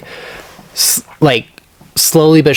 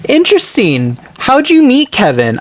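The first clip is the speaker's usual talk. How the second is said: high, sing-song feigned interest; mock innocent curiosity; 11 semitones higher than usual. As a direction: play dumb with a sweet high "interesting".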